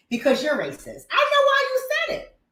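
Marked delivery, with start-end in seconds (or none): 1.1-2.4 high taunting sing-song; mock high-pitched accusation. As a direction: mimic a smug accuser, way up high.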